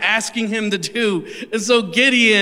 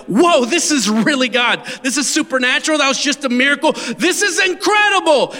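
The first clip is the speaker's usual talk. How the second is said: Gideon amazed; 4 semitones higher than usual. Retold or imitated. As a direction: build from wonder to a cry of awe. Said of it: "Gideon realized".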